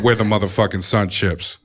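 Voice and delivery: smooth deep voice. Retold or imitated. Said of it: "if I have that vo".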